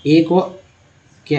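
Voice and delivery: ordinary talk, nothing marked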